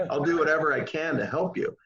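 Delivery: amused, laughing offer. Real, not acted. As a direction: laugh as you promise to help. Laughs while speaking.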